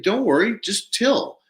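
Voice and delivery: high, bright don't worry; upbeat reassurance. Retold or imitated. Telling panicked Kyle not to worry, high and upbeat. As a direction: pitch it up to calm him down. Calming a panicked farmer on phone.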